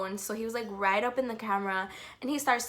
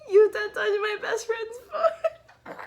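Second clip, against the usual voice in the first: much higher, slow, laughing and teary; overjoyed laughing wail; 11 semitones higher, 6 dB louder, slower than usual. Retold or imitated. Fan gushes idol touched the phone, high slow laughing wail. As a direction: wail it out in delight.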